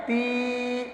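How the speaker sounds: تی with a long, held ee; drawn-out vowel. Shown as a demonstration. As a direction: stretch the ee of تی steadily. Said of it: "تا کو کھینچ کر پڑھیں گے". (تی کو کھینچ کر)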